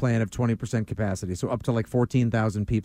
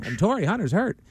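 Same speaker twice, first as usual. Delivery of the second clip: higher-pitched mimicry of a sportscaster; lightly mocking broadcaster impression; Castiglione; 7 semitones higher than usual. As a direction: imitate an announcer's excited call. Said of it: "do I have to say it like Castiglione?".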